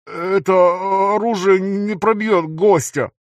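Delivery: high, stammering robotic voice; distorted robotic stutter. A narrator's reading. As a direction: stutter through a distorted mask. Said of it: "искажённым голосом, напоминающим роботизированный".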